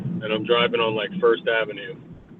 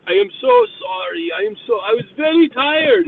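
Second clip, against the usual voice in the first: high-pitched, louder imitation of an Indian accent; hammed-up Indian accent apologizing to the driver he hit; over-the-top Indian-accented apology, much higher; mock Indian accent; 6 dB louder than usual. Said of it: "fucking Indian with his turban".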